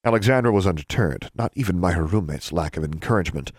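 plain reading voice

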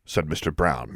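ordinary delivery

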